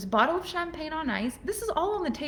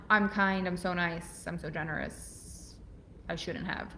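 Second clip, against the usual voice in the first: self-pleasing fake modesty; 5 semitones lower, 6 dB quieter, slower than usual. Shown as a demonstration. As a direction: lower and softer, falsely modest. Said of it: "The worst".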